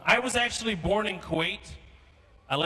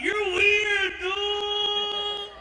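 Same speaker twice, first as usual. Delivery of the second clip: schoolkid; 12 semitones higher, much slower than usual. Acted. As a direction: marvel at the odd new kid in a high voice. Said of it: "this other kid walks up and he's like".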